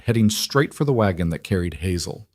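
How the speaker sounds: plain reading voice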